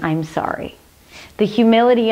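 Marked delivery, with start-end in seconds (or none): 0.0-0.8 soft humble apology. Shown as a demonstration. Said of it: "taking responsibility and saying".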